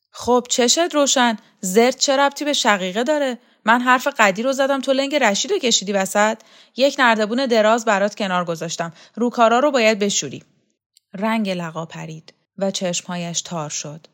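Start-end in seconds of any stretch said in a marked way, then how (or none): 0.1-10.4 loud, higher-pitched sarcastic telling-off; bossy scolding woman. Acted. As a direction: pile chores on with impatient scorn.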